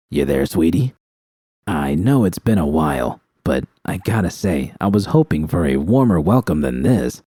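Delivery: a cruel man teasing his victim; Bishop's taunting voice. Acted. Villain Bishop voiced on phone.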